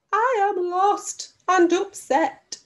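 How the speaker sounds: a high, slow, forlorn little plea; sad penguin voice. Acted. Teacher reading out the penguin's line, slow and high.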